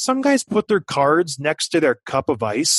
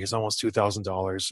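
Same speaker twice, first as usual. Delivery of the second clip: sheepish confession; 8 semitones lower, 8 dB quieter than usual. Retold or imitated. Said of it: "I was like".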